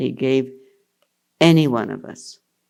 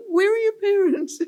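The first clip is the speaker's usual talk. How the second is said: high, laughing imitation of the housekeeper. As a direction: housekeeper puzzled, said through giggles. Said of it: "She'll say".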